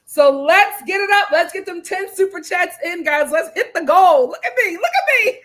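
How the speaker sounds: rally the viewers, pitch climbing; excited hype. Real, not acted. Host rallying viewers for super chats, excited hype.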